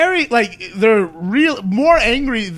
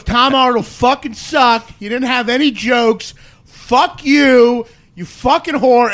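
higher, louder, slow mock yell; drunk heckler yelling; 6 semitones higher, 7 dB louder, slower than usual. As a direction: shout it like a drunk fan. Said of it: "he goes".

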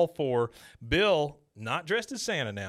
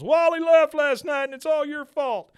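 upset Bill; 8 dB louder than usual. Retold or imitated. Neighbor Bill barges in upset.

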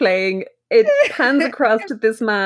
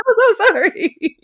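high, louder mock fluster; high flustered apology; 12 semitones higher, 4 dB louder than usual. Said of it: "She's like".

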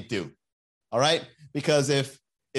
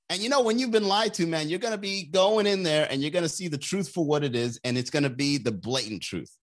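ordinary talk, nothing marked

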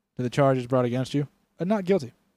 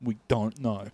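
mock constable; 3 semitones lower, 4 dB quieter than usual. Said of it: "they're like".

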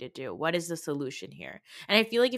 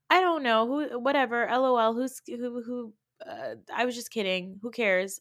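higher, flippant mock voice; mocking Jen; 6 semitones higher than usual. Mocking Jen brushing it off, high and flippant. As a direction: toss it off like a careless brat. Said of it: "She continues with the whole".

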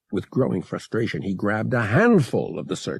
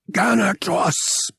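gasping; 11 semitones higher, 3 dB louder, slower than usual. A narrator's reading. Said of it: "she gasped".

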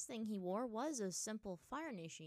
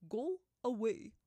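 loud, slow, flatly dismissive monkey; flat dismissal; 3 semitones higher, 10 dB louder, slower than usual. A narrator's reading. Monkey spirit dismisses Aang, flatly. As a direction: send them off in a heavy, drawn-out voice. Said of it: "the monkey said flatly".

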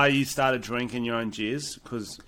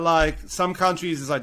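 normal talking voice

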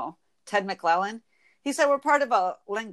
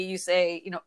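ordinary delivery

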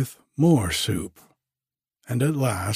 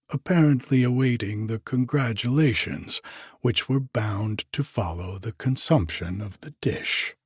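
ordinary delivery, nothing marked

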